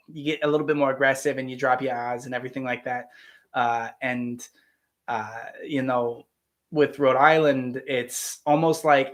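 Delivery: ordinary delivery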